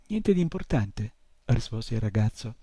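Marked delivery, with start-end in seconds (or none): none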